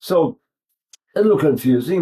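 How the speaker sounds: ordinary talk, nothing marked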